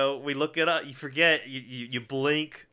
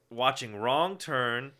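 slow title announcement; much slower than usual. Acted.

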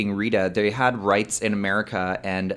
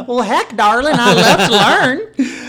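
high, loud Southern twang; 14 semitones higher, 10 dB louder than usual. Acted. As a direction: gush in a hammed-up country belle voice. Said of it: "that's my [name]".

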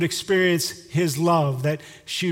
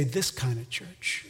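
slow and softer, low answer; soft proud answer; 5 semitones lower, 9 dB quieter, slower than usual. Shown as a demonstration. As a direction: let it land quietly. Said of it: "And you get to say".

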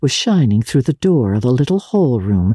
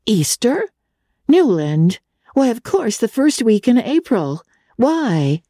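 higher, prim, scandalised sister; shocked sister; 8 semitones higher than usual. A narrator's reading. Prim sister answers her brother, lightly shocked. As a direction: sound mildly shocked at the question.

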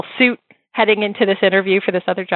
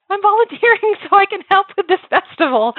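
much higher, laughing through it; a sheepish young volunteer's answer, pitched up; imitating her younger self; 13 semitones higher than usual. [name] answering Deborah at the festival, high self-mocking voice. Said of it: "I'm like".

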